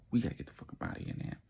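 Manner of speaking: ordinary talk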